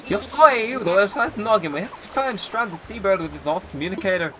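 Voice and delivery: badly faked Irish brogue, swinging high; awful Irish accent. Castaway sailor explaining his lost communicator, awful Irish accent. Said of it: "What is this accent?".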